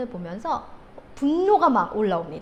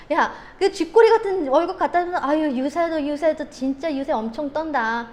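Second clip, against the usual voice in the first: mock a spouse with scornful sarcasm; acted wife's belittling gibe, voice raised; sarcastic jeering; 4 semitones higher, 5 dB louder than usual. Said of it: "비아냥거리고". (남편을 비아냥거리는 아내 연기)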